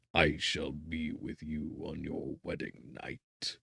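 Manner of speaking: ordinary delivery, nothing marked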